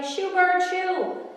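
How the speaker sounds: high, loud shoo; kids shouting. A narrator's reading. Kids on the beach chasing the seagull, shouting. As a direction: shout at the gull to scram. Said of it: "chasing Gary and shouting".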